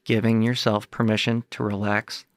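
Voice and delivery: measured meditation guide, long pauses between phrases; guided meditation. Acted. Guide talks listeners through releasing body tension, guided meditation. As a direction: lead listeners through it phrase by phrase, leaving space to breathe.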